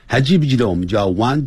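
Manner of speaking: usual speaking voice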